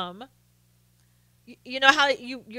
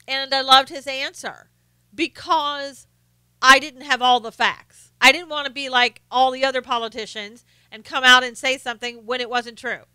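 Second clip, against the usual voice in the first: ordinary talk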